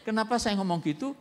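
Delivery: normal talking voice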